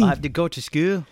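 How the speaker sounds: put-on British little-girl voice. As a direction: mimic a British schoolgirl's accent. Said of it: "It's British".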